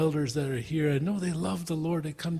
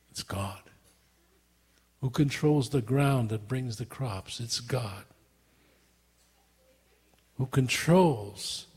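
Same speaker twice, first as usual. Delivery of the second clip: quiet answer; 5 semitones lower, 4 dB quieter than usual. Acted. Preacher's quiet rhetorical questions.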